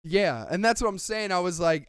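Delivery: normal talking voice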